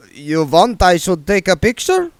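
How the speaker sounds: put-on eager, accented reassurance; Otto vampire accent. A narrator's reading. Otto promising William in an Eastern European accent. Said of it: "said Otto".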